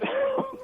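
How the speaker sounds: high little giggle; short happy giggle; overjoyed. Real, not acted. Caller giggles again.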